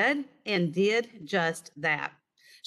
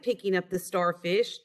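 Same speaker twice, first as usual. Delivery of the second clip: ordinary delivery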